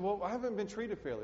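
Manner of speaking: a hint of a sulking excuse-maker; mock complainer. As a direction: play the whining churchgoer. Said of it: "you said".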